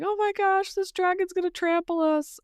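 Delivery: high, hammed-up fright; mock panic. Townsfolk fearing the dragon's charge, high mock panic. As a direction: squeal in mock terror, pitched high. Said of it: "they start freaking out".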